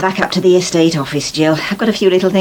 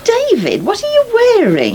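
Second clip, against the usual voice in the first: startled question; 14 semitones higher than usual. Acted. Aunt spotting his odd boots, startled disbelief. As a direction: pitch leaps up in disbelief.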